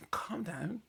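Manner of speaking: high mocking "calm down"; a squeaky patronising "Calm down". Imitating someone telling a vexed friend, high and mocking. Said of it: "someone says to you".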